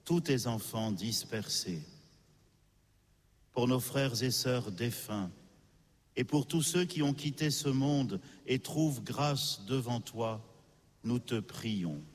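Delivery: usual speaking voice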